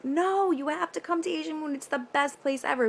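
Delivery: high-pitched pleading with friends; mock insistence. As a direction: talk them into it, pitch jumping up.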